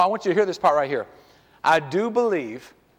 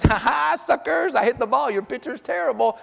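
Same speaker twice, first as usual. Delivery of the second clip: high mock gloat; taunting kid; 5 semitones higher than usual. Son gloating at the other team while rounding the bases, in a high mocking voice. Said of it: "he's like this".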